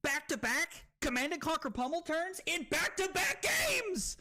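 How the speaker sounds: high-pitched disbelief building to a yell; amazed hype. Real, not acted. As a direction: build to an astonished shout. Amazed at repeated game luck.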